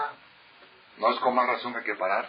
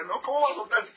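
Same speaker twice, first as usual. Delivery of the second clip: mock fear; 14 semitones higher, faster than usual. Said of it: "el otro se asustó y decía".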